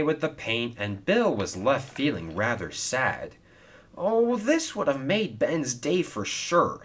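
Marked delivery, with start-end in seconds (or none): 4.0-6.8 glum. A narrator's reading. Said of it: "he said glumly".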